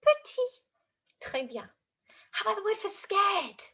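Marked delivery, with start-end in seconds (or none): 0.0-0.6 tiny high squeak; little high-pitched voice; acting out small. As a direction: make the word sound teeny, pitched way up.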